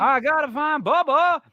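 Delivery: high, slow, plaintive Forrest Gump impression; a slow drawn-out Southern plea. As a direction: call out for Bubba, Forrest-style. Forrest Gump quote, searching voice.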